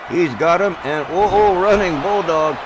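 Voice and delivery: rising excited call. Real, not acted. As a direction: call the big move with a surge. Big move, commentator erupts.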